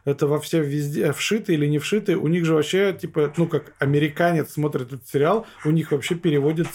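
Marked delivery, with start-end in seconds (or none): none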